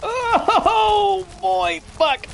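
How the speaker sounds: high-pitched laughing groan into a curse; real, high, startled laughing wail; laughing outcry. Real, not acted. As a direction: cry out through helpless laughter. Startled laughing reaction to game creature.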